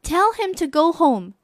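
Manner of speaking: an unbothered mom waving it away; mother brushing off. Acted. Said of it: "said the mother".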